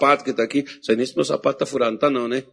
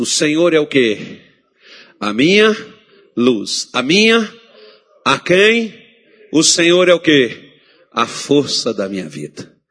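louder, slow emphatic preaching; preaching call-and-response; 5 dB louder, slower than usual. Acted. Pastor quizzing the church on Psalm 27, preaching loud. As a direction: pull the answer out of the crowd.

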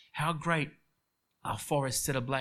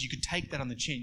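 ordinary talk, nothing marked

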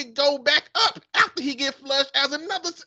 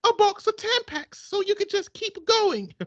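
put-on woman's British accent; 8 semitones higher than usual. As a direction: purr it back like a smitten English lady. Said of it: "man and a woman accent".

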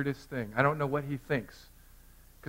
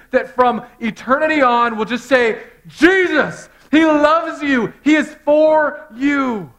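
loud, high soaring sermon climax; full-force preaching; 10 semitones higher, 13 dB louder than usual. Acted. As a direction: build it up and proclaim to the church. Preaching climax about the tapestry.

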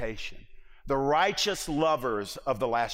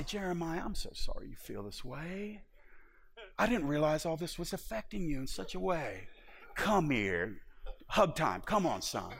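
put-on soft, hushed, cooing like a parent soothing a child; a gently mocking, syrupy comfort with a little laugh; mock tender comfort; 6 dB quieter than usual. God imagined consoling Jeremiah, softly mock tender.

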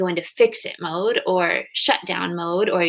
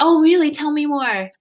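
higher, louder, hammed-up concern on Oh, really; mock exaggerated sympathy; 6 semitones higher, 4 dB louder than usual. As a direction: overplay the caring listener to mock it. Mocking pure compassion for arrow wound.

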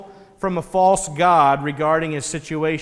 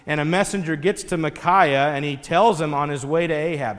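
ordinary talk

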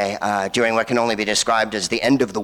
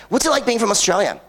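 higher-pitched mock naive question; clueless American; 9 semitones higher than usual. American talks to a Scot, mock clueless high voice. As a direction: ask it high, as a clueless tourist would. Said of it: "they'd be like".

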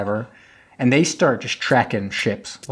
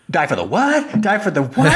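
high, incredulous mock panic; comic retold outburst, pitched up; freaking out; 9 semitones higher than usual. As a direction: act out a disbelieving freak-out, voice climbing. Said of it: "he's, like, freaking out".

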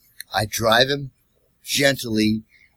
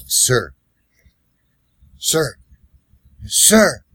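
calling, rising; much slower than usual. Retold or imitated. Waking the passed-out passenger.

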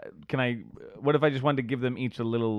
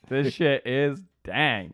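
slow savoring drawl; much slower than usual. Acted. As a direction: slowly savor each word.

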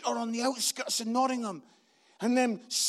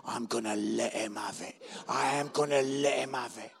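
seething resolve; 12 semitones lower than usual. Retold or imitated. Seething inwardly while critic talks.

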